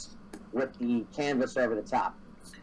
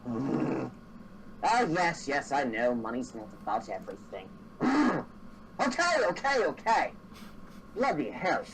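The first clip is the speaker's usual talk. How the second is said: halfling driver; 2 semitones higher than usual. Acted. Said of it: "halfling replies to Grunt".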